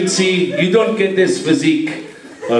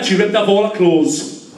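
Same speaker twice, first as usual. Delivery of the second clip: ordinary talk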